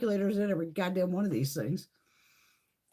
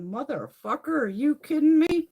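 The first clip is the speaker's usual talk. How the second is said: retold disbelief rising higher and louder; mock outrage; 6 semitones higher, 6 dB louder than usual. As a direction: act out your outrage at the spoiled kid. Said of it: "And I'm going".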